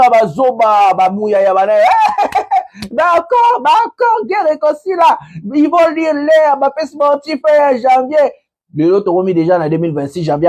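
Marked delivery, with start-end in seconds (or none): 1.8-2.8 high, mocking burst of laughter; derisive laugh. Real, not acted. Commentator scoffing at the regime, high derisive laugh. 2.9-8.3 mocking mimicry. Derisively mimics the regime's claims.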